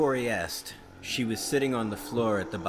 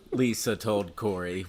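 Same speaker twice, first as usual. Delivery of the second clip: ordinary talk, nothing marked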